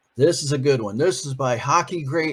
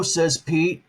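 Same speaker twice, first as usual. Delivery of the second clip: ordinary delivery, nothing marked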